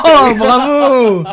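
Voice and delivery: high, loud laughing cheer, slowed. Real, not acted. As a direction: burst out laughing at the pun. Bursting out at a pun.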